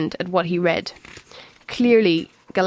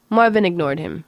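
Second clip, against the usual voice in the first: plain reading voice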